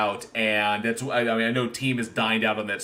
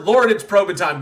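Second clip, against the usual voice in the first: ordinary talk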